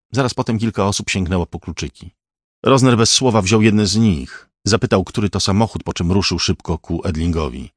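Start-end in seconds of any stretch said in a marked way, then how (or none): none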